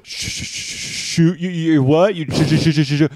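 high, loud stammered incredulity; mocking stutter. Victim throws the mugger's stutter back, mockingly.

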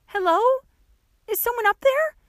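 high, loud call upward. A narrator's reading. Trapped girl calls up out of the grave, high and loud. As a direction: call out for help with little hope of answer. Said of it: "Nix called, not daring to hope".

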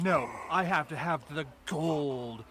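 slow, mock-grand declaration; hammed-up fussy insistence; fancy huffer. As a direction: drag it out like a picky connoisseur. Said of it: "in my head, it was like".

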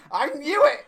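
high, loud shout with laughter around it; retold shout. Sister shouting from the door, loud retold shout. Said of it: "stood in the door and shouted".

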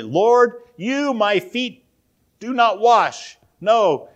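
loud, high, slow protest; strong refusal. Retold or imitated. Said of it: "it's strong".

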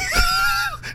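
high shrieking burst of laughter. Real, not acted. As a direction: cackle way up high. Laughing shout.

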